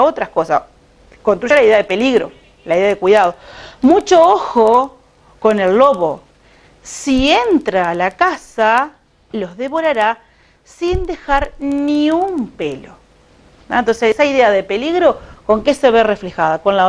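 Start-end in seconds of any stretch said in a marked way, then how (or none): 3.8-12.9 slow, emphatic storybook warning; lectura de cuento. A narrator's reading. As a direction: read the warning out slowly, with pauses between phrases. Reads the goat's warning aloud. (lectura pausada y enfática de cuento)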